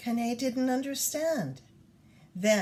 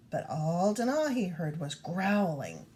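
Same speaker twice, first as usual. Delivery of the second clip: usual speaking voice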